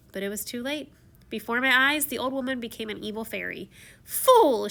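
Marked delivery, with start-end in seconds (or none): 4.0-4.7 loud, higher scornful cry; cried out. Retold or imitated. Fairy scolding the rude prince, loud and high. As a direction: spit the word at him. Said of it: "she cried".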